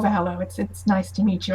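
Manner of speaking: ordinary delivery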